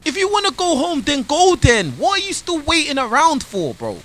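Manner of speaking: high-pitched, incredulous questioning; exasperated challenge. Real, not acted. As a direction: argue back in exasperation. Reactor argues back at the storyteller.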